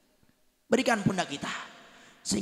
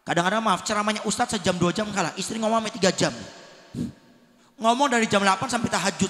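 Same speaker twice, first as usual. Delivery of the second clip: ordinary talk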